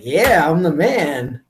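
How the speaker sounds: put-on slow, swooping brag as a proud boy; acted schoolboy swagger; boastful boy. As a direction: stretch it out, cocky and pleased. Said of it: "boys are probably like".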